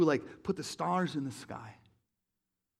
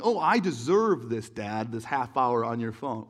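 put-on slow, louder childish pleading; five-year-old; 5 semitones lower, 5 dB louder than usual. Five-year-old begging dad for phone time, slow and whiny. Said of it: "a five-year-old saying".

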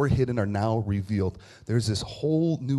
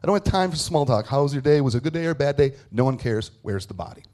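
impatient outburst; 3 semitones higher, 5 dB louder than usual. Acted. Mary to the gardener about the body, impatient. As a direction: brush off all small talk in exasperation. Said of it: "I can imagine her response is".

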